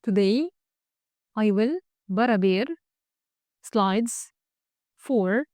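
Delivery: slow, pausing after every word. Shown as a demonstration. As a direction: read it word by word for the checker. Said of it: "تعالوا نجرب". (كلمة كلمة ببطء)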